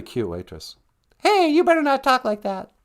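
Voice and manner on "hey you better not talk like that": high, louder mock warning; mock scolding. To her husband about the waitress, mock scolding, high. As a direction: pretend to scold him.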